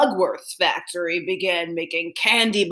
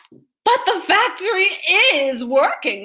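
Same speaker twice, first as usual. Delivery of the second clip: shouted; 11 semitones higher, 4 dB louder than usual. A narrator's reading. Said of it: "the people shouted".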